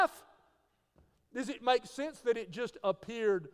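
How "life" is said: loud, high pop on Life; sudden burst. Shown as a demonstration. Preacher acting out life suddenly appearing, sudden burst. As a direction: break the hush with an abrupt exclamation.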